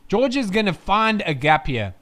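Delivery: ordinary talk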